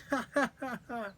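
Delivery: put-on high girlish giggling laugh; high giggle. A narrator's reading. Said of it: "laughed Amber".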